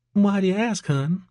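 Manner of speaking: high-pitched Georgia waitress; playful question in a high Georgia accent. A narrator's reading. Waitress answering a curious customer, coy Georgia accent. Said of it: "an accent that was distinctly from Georgia".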